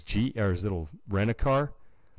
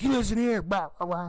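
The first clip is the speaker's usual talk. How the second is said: yelling. Retold or imitated. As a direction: tear into him like an angry lady. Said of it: "yelling at him".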